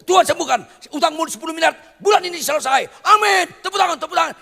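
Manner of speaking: high, loud hammed-up miracle preacher shouting amen; mock prosperity preacher. Preacher mocking a miracle preacher who promises debts paid, shouted. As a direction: parody a showy healer working the crowd.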